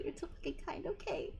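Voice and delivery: soft unvoiced thanks; touched whisper. Real, not acted. Streamer softly thanking Homic, whispered and touched. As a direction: whisper it, moved.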